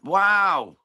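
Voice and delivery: a real, loud burst of surprise; surprised wow. Real, not acted. Hearing the split decision result, loud surprised wow. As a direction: blurt it out, caught off guard. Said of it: "I'm surprised at that".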